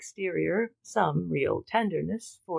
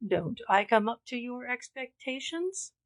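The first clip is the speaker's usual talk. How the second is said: higher, mocking challenge; truculent; 6 semitones higher than usual. A narrator's reading. Father taunting his daughter, truculent. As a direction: taunt her with a sneer in the voice. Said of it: "he cried truculently".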